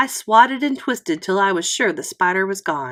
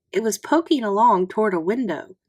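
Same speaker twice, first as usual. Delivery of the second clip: ordinary delivery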